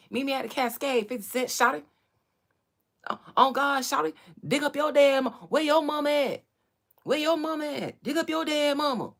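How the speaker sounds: high, loud Atlanta yelling; hyped Atlanta shouting. Retold or imitated. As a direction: holler it like an A-Town rapper. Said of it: "how y'all say it in Atlanta".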